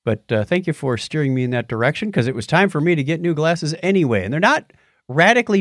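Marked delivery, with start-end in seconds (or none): none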